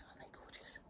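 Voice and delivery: "gorgeous" breathed as a near-silent whisper. Real, not acted. Admiring flowers while the house sleeps, near-silent whisper. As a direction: barely whisper "Aren't they gorgeous?". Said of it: "And I'm whispering because".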